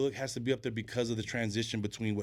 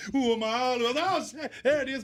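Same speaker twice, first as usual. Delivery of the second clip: laughing recall; 13 semitones higher, 5 dB louder than usual. Retold or imitated. Laughing, recalls reciting lyrics.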